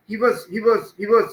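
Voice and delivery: WAS short, as "wuz"; unstressed was. Shown as a demonstration. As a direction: say "was" light and reduced after "he". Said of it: "not was".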